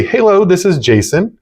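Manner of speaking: cheerful answering voice; upbeat phone greeting. Shown as a demonstration. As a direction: greet the caller with energy. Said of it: "be very upbeat and go".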